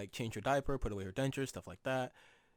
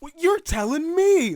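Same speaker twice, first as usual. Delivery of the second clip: high, loud, stretched-out protest; mock genuine bafflement; 14 semitones higher, 11 dB louder than usual. Retelling great-grandma's baffled comeback, exaggerated high protest. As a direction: imitate sincere disbelief, drawn out and loud. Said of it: "she said it with such, like, genuine".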